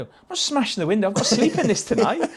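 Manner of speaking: high-pitched mock protest; indignant refusal. Refusing to smash the window of the car to sleep in, indignant. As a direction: act out an indignant no, pitch jumping up.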